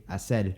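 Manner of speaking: hacer said as hacel; Puerto Rican l. Shown as a demonstration. Teacher imitating Puerto Rican speech. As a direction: end hacer on l. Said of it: "they'd say".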